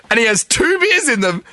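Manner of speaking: normal talking voice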